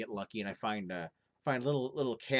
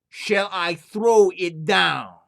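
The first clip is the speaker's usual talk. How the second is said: slow, drawn-out and louder ghostly call; the spirit calls down more insistently; eerie chimney voice, louder; 7 semitones higher, 15 dB louder, much slower than usual. Acted.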